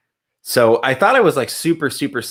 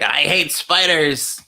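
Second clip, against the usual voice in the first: a hint of a small, slow squeaky voice; little voice; 4 semitones higher, much slower than usual. Friend teasing with her little voice, lightly done and slow. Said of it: "I just used your little voice".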